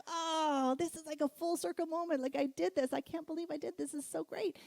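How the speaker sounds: high, thrilled and proud of herself; proud wife telling her husband she did it; excited. Retold or imitated. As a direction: gush about it a touch breathlessly. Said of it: "And I'm like".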